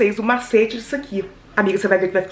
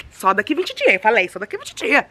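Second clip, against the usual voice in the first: ordinary talk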